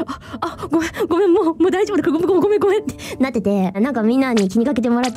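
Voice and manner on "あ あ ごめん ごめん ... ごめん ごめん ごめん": 軽く真似た焦った謝り; mock panic. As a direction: stammer sorry in a mild imitated panic. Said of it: "ちょっとすごい焦ってた".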